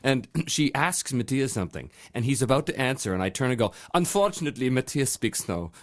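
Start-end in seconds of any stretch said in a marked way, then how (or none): 4.0-5.7 mock German accent. Prankster telling girls his friend has no English, mock German accent. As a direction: explain it with a put-on German accent. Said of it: "I begin to speak like Matthias".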